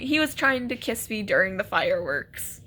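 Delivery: usual speaking voice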